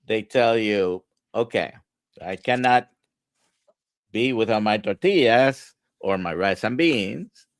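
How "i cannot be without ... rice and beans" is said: ordinary talk, nothing marked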